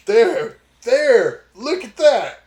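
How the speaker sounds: triumphant, pointing it out; excited pointing. Acted. Pointing at the body cam excitedly.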